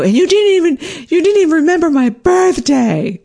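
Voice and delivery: high, loud indignant retelling of the outburst; accusing outburst. Retold or imitated. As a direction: hurl the accusation at him. Accusing partner in the kitchen.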